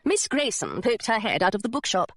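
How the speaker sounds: plain reading voice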